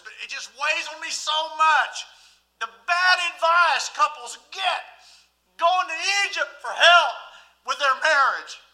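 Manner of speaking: high, loud pulpit lament; impassioned preaching. Real, not acted. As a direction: hammer it out to the congregation, heavily burdened. Preaches at full force.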